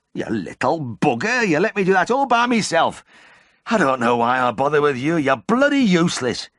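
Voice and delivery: high, loud enraged yell; furious farmer. Acted. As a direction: roar it at the useless farmhand. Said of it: "glared up at him with frustrated rage".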